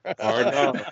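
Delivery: high, slurred Aussie R and R; slurred Australian. Shown as a demonstration. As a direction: slur it like an Aussie. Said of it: "If you just say R N R, like, kinda slurred".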